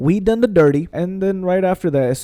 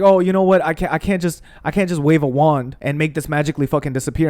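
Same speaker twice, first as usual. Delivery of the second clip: ordinary delivery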